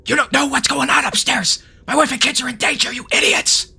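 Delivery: angry mayor yelling in a whisper; mayor shout-whispering at intruders in his house. Acted. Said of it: "he's gonna yell at you, but he's gonna whisper it".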